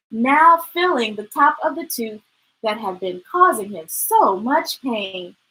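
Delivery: usual speaking voice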